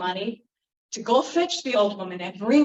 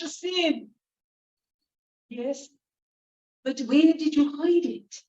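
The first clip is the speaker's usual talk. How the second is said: higher, lightly voiced servant; contrite servant; 6 semitones higher, 3 dB louder than usual. Servants confessing to the old woman, lightly voiced. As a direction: confess sheepishly.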